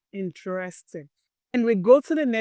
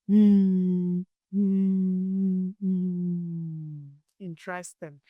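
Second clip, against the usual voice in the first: normal talking voice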